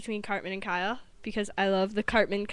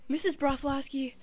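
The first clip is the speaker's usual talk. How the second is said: slow, raised-pitch doctor impression; 5 semitones higher than usual. Doctor questioning the boy's mother, slow put-on concerned voice. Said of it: "And the doctor's like".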